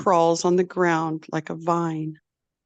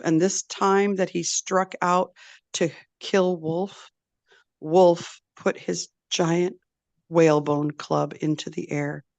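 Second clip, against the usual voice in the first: ordinary delivery, nothing marked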